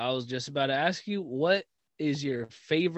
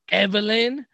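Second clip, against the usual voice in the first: raised, higher questioning call; louder, rising name call; imitated dad calling out; 6 semitones higher, 8 dB louder than usual. Dad discovering them, calling daughter.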